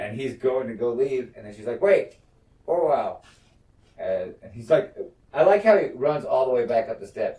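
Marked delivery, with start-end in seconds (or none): 1.8-3.2 higher, louder call to stop someone; sudden raised call, acted. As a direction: call after someone who is leaving. Queen calls back departing Orwell.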